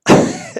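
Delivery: brief, high, loud laugh; quick high burst of laughter; real laugh. Real, not acted. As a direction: let out a sudden loud laugh. Reacts to replayed clip.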